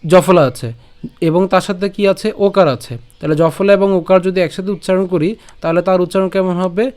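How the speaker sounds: ordinary talk, nothing marked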